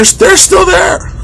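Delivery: fed-up yell at people still blocking the aisle; exasperated shout. Real, not acted. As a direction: drag it out in disbelief.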